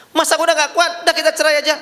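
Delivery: high, pleading mock wife; whiny wife voice. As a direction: whine it out like a fed-up wife. Wife asking for divorce again.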